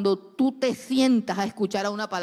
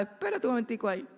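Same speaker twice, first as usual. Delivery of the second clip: oración intensa y aguda, en tono de guerra espiritual; oración de madre al escuchar el noviazgo; 6 semitones higher than usual. Retold or imitated. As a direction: clama con autoridad contra el noviazgo del hijo. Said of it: "yo me enteraba y no decía nada". (madre imitándose orando contra la novia del hijo, tono agudo e intenso)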